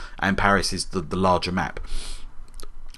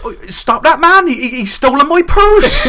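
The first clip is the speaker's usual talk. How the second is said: very high, loud mock Cockney cry; hammed-up Cockney theft victim yelling; really Cockney; 9 dB louder than usual. Imitating a robbed townsperson raising the alarm, shrill-pitched Cockney. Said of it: "either Cornish or really Cockney".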